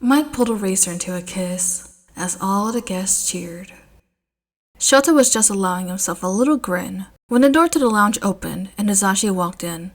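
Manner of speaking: ordinary delivery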